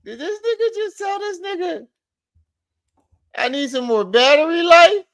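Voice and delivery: very high-pitched disbelief; incredulous. Real, not acted. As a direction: squeal the question, unable to believe it. Disbelief at the battery excuse.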